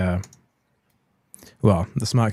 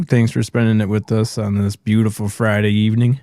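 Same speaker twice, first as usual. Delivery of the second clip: ordinary delivery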